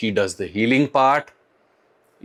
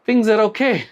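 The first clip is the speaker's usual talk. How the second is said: higher, upbeat and playful; cheery lighten up; 9 semitones higher than usual. Real, not acted. A vlogger cheering up his viewers, higher and upbeat. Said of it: "Lighten up, folks".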